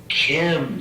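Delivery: normal talking voice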